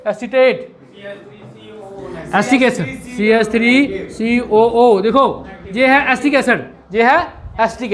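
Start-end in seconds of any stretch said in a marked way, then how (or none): 3.1-5.0 each letter drawn out slowly; slow dictation. Shown as a demonstration. Teacher dictates formula. (अक्षर-अक्षर धीरे बोलते हुए)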